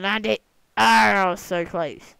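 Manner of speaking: loud, lively cheer at the near miss; excited shout. Real, not acted. Gamer cheering on a landing, loud and excited. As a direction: shout it out as the bird lands.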